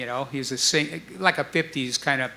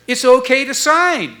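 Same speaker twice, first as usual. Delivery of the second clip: high, louder announcement; final answer; 8 semitones higher, 7 dB louder than usual. Retold or imitated. Stu giving the band the go-ahead, high and loud. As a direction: deliver the good news.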